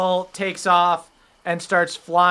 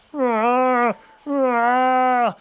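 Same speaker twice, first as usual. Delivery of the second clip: bear grunt; 8 semitones higher than usual. Acted. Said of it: "you hear the bear go".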